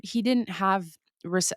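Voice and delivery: ordinary talk, nothing marked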